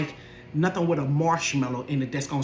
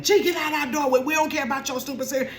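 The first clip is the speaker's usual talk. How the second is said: mock holler; 9 semitones higher, faster than usual. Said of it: "And they hollering".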